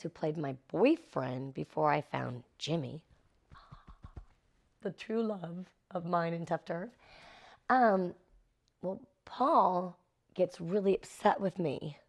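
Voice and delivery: ordinary talk, nothing marked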